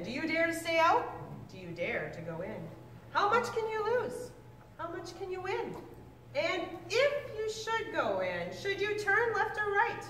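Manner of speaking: lively storybook read-aloud, pitch swinging up and down; reading to children. Acted. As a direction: read it like a picture book to a class of kids. Teacher reads Seuss book to class.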